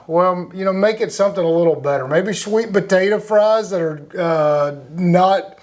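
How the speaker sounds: usual speaking voice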